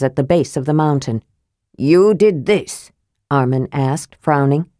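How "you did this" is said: a dwarf lord eyeing the strangers with suspicion; mildly suspicious question; slow suspicious dwarf lord. Acted. Armen questions the companions.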